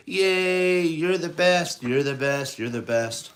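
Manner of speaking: loud high cheering that fades down; great cheer. A narrator's reading. Gang cheering their boss Ratigan, loud then fading. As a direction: cheer hard, then let it settle. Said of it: "they gave a Ratigan a great cheer".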